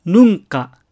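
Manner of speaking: "ヌンカ" as Spanish nunca, n said as ng; ng before the k in ヌンカ; velar n. Shown as a demonstration. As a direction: back of the tongue closes for ン in ヌンカ. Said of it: "後ろ舌面と軟口外が密着する".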